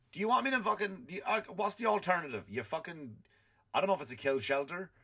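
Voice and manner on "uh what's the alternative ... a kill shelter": ordinary delivery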